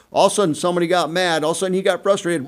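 fast, rising excitement; excited. Retold or imitated. Building frenzy in story.

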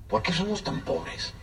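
soft, slow, hurt question; sad child's question. Retold or imitated. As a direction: ask it quietly like a hurt little boy. Child asks grandmother why poor. (pregunta triste de niño, suave y lenta)

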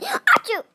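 high pretend achoo; fake sneeze. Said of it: "Oh, I sneezed".

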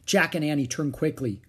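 ordinary delivery

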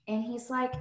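usual speaking voice

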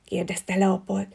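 ordinary delivery

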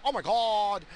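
a light high mock of gossiping girls; lightly voiced gossip girl. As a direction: ham up a gossipy friend a little. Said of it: "what girls complain about".

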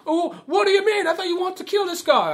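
high, protesting put-on voice; mock indignant surprise. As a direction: imitate a baffled, aggrieved complainer. Acted protest from film.

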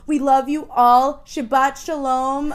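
slow, loud, affectionate goodbye; lovey dovey. Real, not acted. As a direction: send them off with love.

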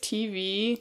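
under her breath. Acted. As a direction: slip it in softly. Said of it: "She says it under her breath".

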